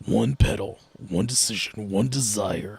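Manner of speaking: a dramatic perfume-ad voice sinking to a hush; mock ad slogan. Host fakes a pedal ad tagline, low and fading to a whisper.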